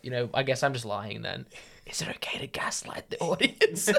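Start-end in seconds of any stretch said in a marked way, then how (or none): 1.9-3.9 hushed conspiratorial whisper. Acted. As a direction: whisper it like a secret aside. Joking aside to co-hosts.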